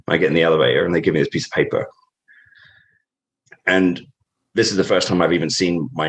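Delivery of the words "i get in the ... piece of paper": ordinary talk, nothing marked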